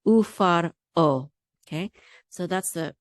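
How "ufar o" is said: ufar o, slow and loud, o set apart; separated syllables. Shown as a demonstration. Teacher correcting a syllable break, slow and separated. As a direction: keep the r out of the last syllable of ufar o. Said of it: "The resh is not part of this syllable".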